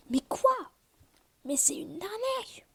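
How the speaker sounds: high shriek, then a slow indignant whine; shocked outrage. Real, not acted. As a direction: yelp in disbelief, then drawn-out complaint. Gets a scam egg again.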